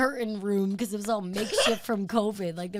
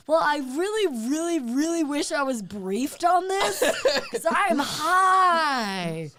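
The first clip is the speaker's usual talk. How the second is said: higher, emphatic comic complaint; mock exasperation; 4 semitones higher, 3 dB louder, slower than usual. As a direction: build to a loud, drawn-out last word. Retold exasperated complaint to doctor.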